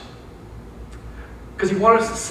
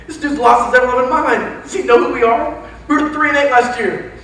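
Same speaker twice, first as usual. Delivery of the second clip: raised, louder incredulous protest; mock disbelief; 5 semitones higher, 5 dB louder than usual. Players reacting to their nutty new coach, incredulous. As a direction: imitate baffled players protesting.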